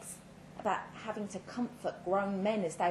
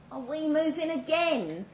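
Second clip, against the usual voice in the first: put-on higher, louder little boy's worried question; a small boy asking his mum, anxious; young son; 9 semitones higher, 4 dB louder than usual. A narrator's reading. Son asks his crying mother, a worried child's voice.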